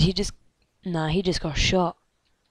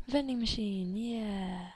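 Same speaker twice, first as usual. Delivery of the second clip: ordinary talk, nothing marked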